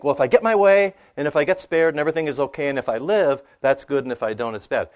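loud, high mock voice of anxious self-interest; mock worldly thinking. Preacher mocks the wrong way of thinking, loud and high. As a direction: belt out the wrong-headed worry, a bit hammed-up. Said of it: "that's the wrong paradigm".

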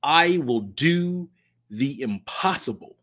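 slow, deliberate declaration; solemn slow opener; slow pledge phrase. Shown as a demonstration. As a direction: set the words down one by one like a pledge. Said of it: "starts with the phrase".

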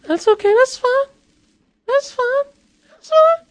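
high falsetto repeating that it's fine; mock fine. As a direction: squeak out a pretend-unbothered fine. Mock shrug after being fooled.

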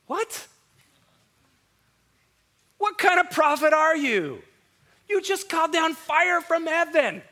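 high, loud disbelief; shocked at the prophet's cowardice; incredulous. Real, not acted. Preacher's incredulous outburst.

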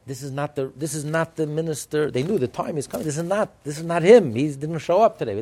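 loud, rising urgent warning; urgent hinting. Retold or imitated. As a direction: push the warning harder and harder. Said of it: "The guard was hinting".

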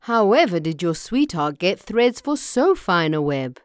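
loud, amazed farmer; astonished cry. A narrator's reading. Farmer asks Vaco about the web, amazed. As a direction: ask in loud wonderment. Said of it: "he cried".

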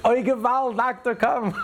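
yelling in Yiddish. Retold or imitated. Woman in labor finally cries to the doctor in Yiddish, high wail. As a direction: wail it like a woman in labor who drops the French. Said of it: "she yells out in Yiddish".

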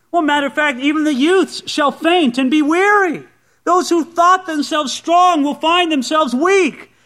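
high, emphatic preacher's challenge; impassioned preaching. Acted. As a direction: drive each point home to the congregation. Preaches at full force.

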